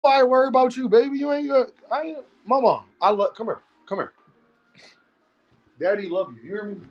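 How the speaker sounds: soft, cooing voice for a pet; daddy comforting his pet, soft and loving. Real, not acted. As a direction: sweet-talk the dog gently. Talking tenderly to his pet.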